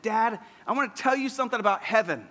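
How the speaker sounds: a hint of an eager toddler telling dad a secret; three-year-old son. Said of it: "and he said".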